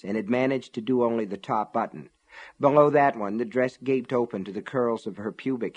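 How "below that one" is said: usual speaking voice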